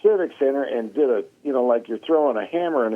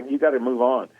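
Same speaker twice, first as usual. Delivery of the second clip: ordinary delivery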